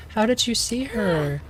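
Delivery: high mock-sceptical voice; high doubting sister. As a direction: ask it like you don't believe her. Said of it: "she's just like".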